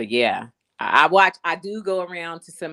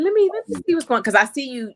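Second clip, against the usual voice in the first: high excited quote; 11 semitones higher, 5 dB louder than usual. Retold or imitated. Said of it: "I said".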